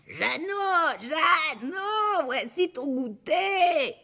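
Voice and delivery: high, slow, cracked shout; cracked ogress voice. Acted. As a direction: shout with a broken old voice. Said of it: "d'une voix toute cassée". (voix toute cassée de l'ogresse, plus aiguë)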